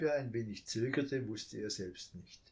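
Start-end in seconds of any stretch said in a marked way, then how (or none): none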